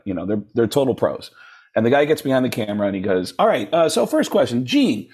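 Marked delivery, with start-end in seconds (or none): 3.4-5.1 lightly voiced producer, higher pitch; interviewer. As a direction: put on a touch of a TV interviewer. Voices interviewer asking first question.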